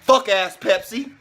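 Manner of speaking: loud, high, shouted tirade; raging shout against the commercial; furious rant. Real, not acted. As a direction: rip into Pepsi at full volume. Angry rant at Pepsi ad.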